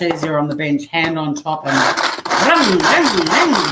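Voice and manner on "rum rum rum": loud, higher rhythmic chop-chop sounds; mock chopping noises in time with the blade. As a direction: sound out each chop of the dicer. Chopping noise while dicing onion.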